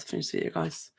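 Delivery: normal talking voice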